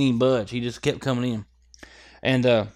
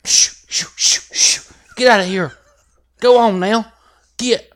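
higher, louder shouts to scare it off; shooing yell; 7 semitones higher, 7 dB louder than usual. Retold or imitated. Hunter reenacting his yells at the bear for the audience. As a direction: shoo the bear away, voice up high. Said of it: "Reenact the whole thing".

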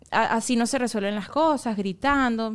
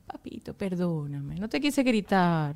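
slow, lower-pitched soothing apology; tender apology; 4 semitones lower, slower than usual. Retold or imitated. Mother apologising to her little boy after yelling, tender. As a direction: ease slowly into saying sorry.

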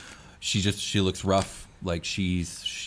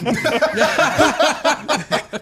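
high burst of laughter; loud laugh; 9 dB louder than usual. Real, not acted. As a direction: let the laugh explode. Whole table laughs.

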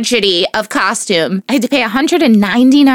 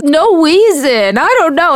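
put-on higher, louder, slow little boy voice; imitating her son; 6 semitones higher, 3 dB louder than usual. Mother retells her son's answer, higher child voice. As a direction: mimic a sulky small boy. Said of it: "And he goes".

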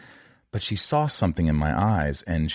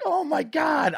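much higher, a shouted sobbing cry; sobbing outcry; slower than usual. Retold or imitated. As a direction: let it burst out mid-sob. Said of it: "I'm just bawling".

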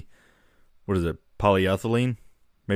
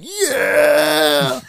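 excited cheer; 6 semitones higher, 5 dB louder than usual. Retold or imitated. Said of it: "and they were like".

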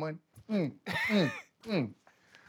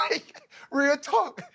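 high, cracking laugh on the words; squealing with laughter; laughing exclamation; 9 semitones higher, much slower than usual. Real, not acted. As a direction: burst out laughing as you say it. Laughing while vouching for it.

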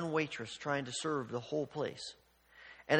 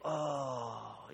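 long falling, let-down ohh; drawn-out sigh of disappointment, sinking away; disappointed crowd "oh"; 4 semitones lower, 4 dB quieter than usual. Retold or imitated. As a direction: mimic diners watching their food pass by. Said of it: "and then they'd take their table and go".

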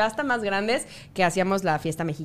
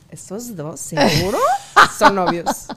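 slow, scandalized aside with a laugh; mock shocked whisper-question; 5 dB louder, slower than usual. To herself at a Miami boat party, slowly scandalized. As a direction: gasp it out like a prude seeing sin. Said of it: "Y yo lo observaba todo y yo".

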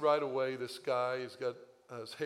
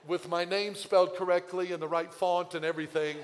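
ordinary talk

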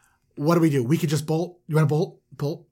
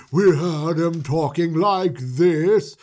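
slow, loud put-on manager voice; manager's assumed voice; 6 dB louder, much slower than usual. Shown as a demonstration. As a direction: drag it out in a big put-on voice. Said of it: "But in truth, his, his voice".

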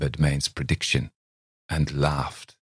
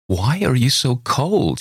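higher, slow wistful question; wounded reproach; 8 semitones higher, 3 dB louder than usual. A narrator's reading. Miss Thayer asks cold Campbell, slow and wounded.

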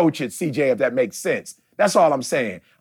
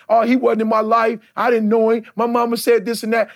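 higher, louder mocking whine of his old self; mock old complaint; 7 semitones higher, 3 dB louder than usual. Pastor mocking his younger self's complaint, higher and louder. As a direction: parrot his old grievance, exaggerated. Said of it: "talking about my father the same way".

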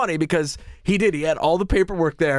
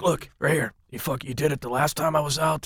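[name]'s impression; 5 dB quieter than usual. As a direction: point at the slips in a flat grumble. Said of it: "He's like".

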